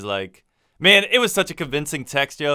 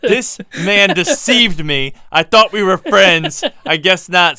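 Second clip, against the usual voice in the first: high, loud, slow drawn-out complaint; theatrical fake outrage; mock betrayal; 5 semitones higher, 8 dB louder, slower than usual. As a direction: play the wronged friend, hammed up. Mock betrayed rant at co-host.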